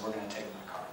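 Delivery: smug and calm, barely above a murmur; quiet smile. Retold or imitated. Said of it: "he kinda smiled and he said".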